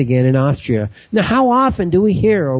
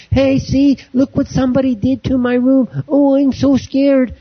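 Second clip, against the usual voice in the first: high-pitched, hammed-up fright, drawing out the end; badly faked fear, pitched way up; mock frightened student; 11 semitones higher than usual. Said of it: "so paranoid and so freaked out".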